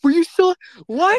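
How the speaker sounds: high, loud disbelief; stunned, high-pitched challenge; incredulous. Real, not acted. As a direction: cut in, voice shooting up in disbelief.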